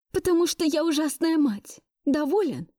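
high, strained angry retort; hissing through teeth. A narrator's reading. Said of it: "прошипела я сквозь зубы". (сдавленно и зло, сквозь зубы)

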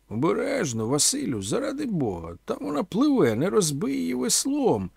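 high-pitched, theatrical tirade; pompous outraged complaint, pitched high; indignant cursing. A narrator's reading. Omela, just fished out of the pond, scolding grandly. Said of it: "лаявся Омела".

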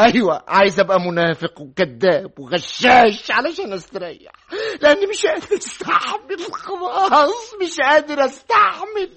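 high, loud, slow sobbing cry; crying outburst. Acted. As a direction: break down and wail the words. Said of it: "أنت بتعيط؟".

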